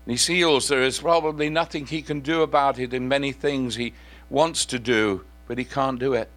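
ordinary delivery

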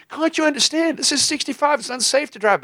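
very high, loud mock pleading; exasperated protest. Driver begging the trucker to understand sixty-five, high frantic mock protest. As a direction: plead in a comic squeal, over the top.

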